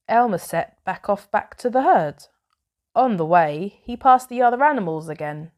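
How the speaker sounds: ordinary delivery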